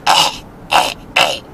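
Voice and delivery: grunt, then ask it like the odd little manager; imitated Turkish manager. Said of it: "he had a little accent".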